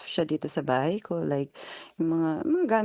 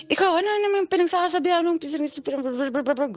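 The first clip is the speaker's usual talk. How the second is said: mock sputtering boyfriend; 10 semitones higher than usual. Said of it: "gumanon talaga siya".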